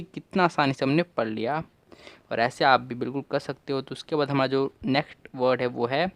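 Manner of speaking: normal talking voice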